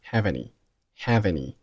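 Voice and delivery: "have any" run together as "ha-vany"; "have any" with no pause between the words; linked reading. Shown as a demonstration. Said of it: "have和any发生辅元连读".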